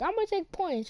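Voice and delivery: high voice for the teacher; a light imitation of the teacher; teacher threat. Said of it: "she's like".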